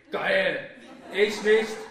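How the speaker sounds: loud, laughing jeer then a defiant yell; laughing shout. Acted. Mocking her lovers with a loud laughing shout. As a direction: bellow it out, laughing at them.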